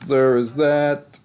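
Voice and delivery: repeating the sign-off, slow and mock-dramatic; slow put-on sign-off. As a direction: stretch it out like a grand closing. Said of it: "repeated tag line".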